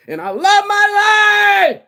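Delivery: long, high, full-out shout; drawn-out, soaring yell of joy; exuberant scream. Shown as a demonstration. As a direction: scream it so the neighbors hear. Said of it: "I scream that shit on a regular basis".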